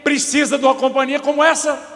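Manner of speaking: wife venting to the pastor, heated; exasperated complaint. Retold or imitated. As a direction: let the frustration pour out. Wife's heated complaint retold.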